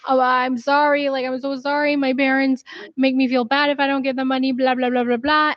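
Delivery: lightly mimicking a teary apology; mock apologetic Scheana. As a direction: brush it off with blah, blah. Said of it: "she's like".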